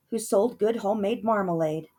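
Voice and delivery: ordinary delivery, nothing marked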